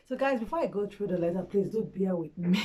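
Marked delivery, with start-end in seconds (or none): none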